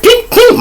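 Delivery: high sharp ping, ping; refund ping sound. Retold or imitated. Comic sound of the tax refund coming back, pinged up high. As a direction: chirp the ping of money landing.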